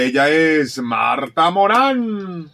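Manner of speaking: big hyped radio announcer; announcer intro. Acted. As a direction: build it up like a show host.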